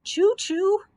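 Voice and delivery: high, loud wail of dismay. Acted. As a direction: wail the name out in despair. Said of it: "wailed the Scarecrow".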